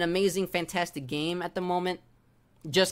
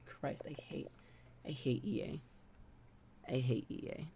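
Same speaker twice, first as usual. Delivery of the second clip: bitter mutter; 4 semitones lower, 14 dB quieter than usual. Real, not acted. Quiet muttered hatred.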